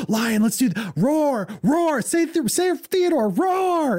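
put-on high, eager parent coaxing a toddler; coaxing roar. Parent coaxing his son to roar, high and eager. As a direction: hype up the roar for the baby.

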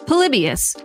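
normal talking voice

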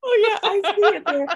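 mock the psychic while cracking up; laughing impression of psychic agreeing.

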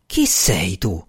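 low, slow, choked challenge; strangled voice. A narrator's reading. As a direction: force the question slowly from a tight throat. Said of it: "esclamò con voce strozzata".

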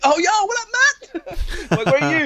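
squeaky high, laughing hey-buddy call; excited greeting. Retold or imitated. Player hearing his mate on the walkie-talkie, high and laughing.

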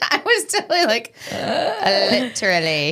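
high laughing burst; laughing helplessly through the words; reluctant amusement. Real, not acted. Cracking up over bullet point.

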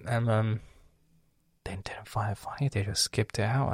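ordinary delivery

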